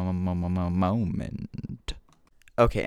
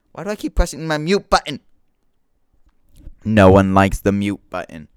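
exasperated shout at himself; loud frustration; 2 semitones higher, 15 dB louder than usual. Real, not acted. Annoyed at his own mute button, a real loud exasperated outburst.

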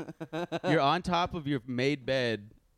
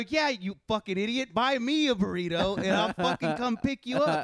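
higher-pitched retold scolding; exasperated anger; 8 semitones higher than usual. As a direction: berate a friend who won't listen. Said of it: "I'm getting so mad".

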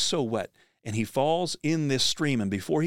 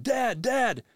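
much higher calling-out voice; lightly voiced kids shouting; yelling for dad; 13 semitones higher than usual. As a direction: boys calling their father from the cliff. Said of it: "they're yelling for their dad".

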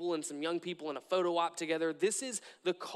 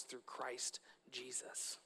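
hushed whisper; 6 semitones lower, 15 dB quieter, slower than usual. Real, not acted. Preacher to congregation, softly whispered. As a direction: drop to a whisper on the name. Reverent close of a point.

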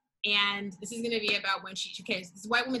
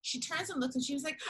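ordinary talk

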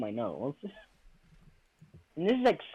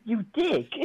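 louder, higher, playfully angry; annoyed scolding; 6 semitones higher, 4 dB louder than usual. Real, not acted. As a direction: snap at the cat. Scolding the biting cat.

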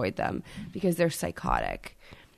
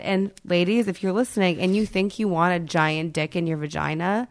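ordinary talk, nothing marked

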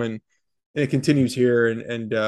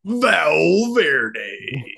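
higher, louder drawn-out monster truck announcer; 5 semitones higher, 5 dB louder than usual. Pretend. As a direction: boom it out like a monster truck rally ad. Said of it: "talk in either wrestling or monster truck voices".

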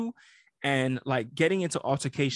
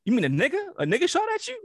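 rising higher with each question, incredulous; mock disbelief; 9 semitones higher than usual. Mocking the caller's slur with exaggerated disbelief. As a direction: play dumb, pressing him in disbelief. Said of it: "I'm like".

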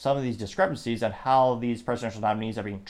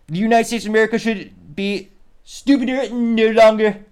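much higher and louder, hammed-up bad Trump accent; butchered Donald Trump accent; 10 semitones higher, 8 dB louder than usual. Reading Trump's all-caps post to viewers, hammed-up bad Trump accent. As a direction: ham up a bad Trump impression, high and loud. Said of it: "I could attempt to butcher his accent".

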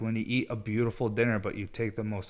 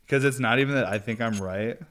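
ordinary talk

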